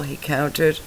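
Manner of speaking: ordinary delivery, nothing marked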